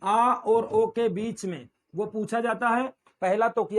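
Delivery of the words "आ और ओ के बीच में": आ और ओ के बीच में drawn out word by word; very slow emphasis. Shown as a demonstration. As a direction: hammer the answer home slowly. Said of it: "किसके किसके मध्य?". (हर शब्द धीरे और ज़ोर से)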